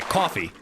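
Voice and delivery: "Coffee" with a heavy AW, KAW-fee; thick pronunciation. Shown as a demonstration. Streamer after calling it thick, "Coffee" as heavy KAW-fee. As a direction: lean on the rounded first vowel of "Coffee". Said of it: "That was thick".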